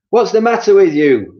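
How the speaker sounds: a high, loud, grumpy Mister Twit. Acted. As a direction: bark it at your wife, high and loud. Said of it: "Mister Twit said".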